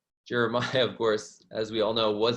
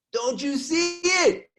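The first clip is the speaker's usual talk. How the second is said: insistent excitement; 14 semitones higher, slower than usual. Retold or imitated. Archaeologist insists.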